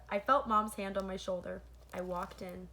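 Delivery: ordinary delivery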